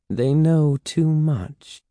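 slow, high, silky answer; slow, lightly menacing reply; soft reply. A narrator's reading. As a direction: stretch the words out, pitched high. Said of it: "Sarah Beth replied softly".

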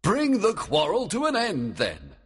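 a king laying down terms; Oberon. A narrator's reading. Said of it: "Oberon said".

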